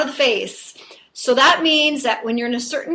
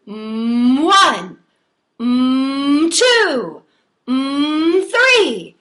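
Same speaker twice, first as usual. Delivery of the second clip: humming into each counted word, louder, rising; buzzy speech pattern; 5 dB louder, much slower than usual. Shown as a demonstration. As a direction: keep the buzz as you count. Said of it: "So it's still really buzzy".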